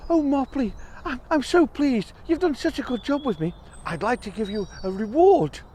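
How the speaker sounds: high grateful bird voice. Acted. Bird tells the mouse her wing healed, high and grateful.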